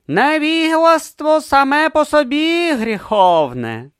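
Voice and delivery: high, slow cutting retort; curt retort. Acted. Vorbis curtly cutting off Brutha's excuse. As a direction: cut him off sharply. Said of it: "відрізав Ворбіс".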